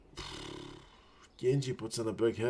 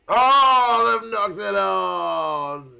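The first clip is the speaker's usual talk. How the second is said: a high loud "Oh" sliding into a very slow groaning line; dismayed cry; 9 semitones higher, 8 dB louder, much slower than usual. Real, not acted. Fan dismayed when his team knocks on, crying out. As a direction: cry out at the dropped ball.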